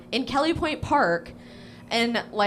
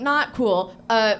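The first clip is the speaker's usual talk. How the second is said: ordinary delivery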